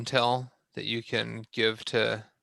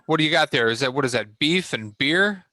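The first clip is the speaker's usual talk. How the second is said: high mock-surprised question; 5 semitones higher, 7 dB louder, faster than usual. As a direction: act amazed at the beer. Bort mock-surprised at food.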